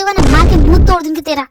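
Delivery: loud threatening yell at her brother; shouted threat. Acted.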